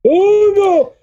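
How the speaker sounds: high mock wail; panicky cartoon character. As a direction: wail it high, hammed-up dread. Cartoon character impression exchange.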